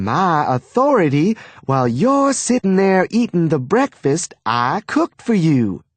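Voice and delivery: high, indignant Southern mother; stern mother. A narrator's reading. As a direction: scold him with prim indignation. Wife confronting husband at breakfast.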